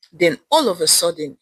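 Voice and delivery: ordinary delivery, nothing marked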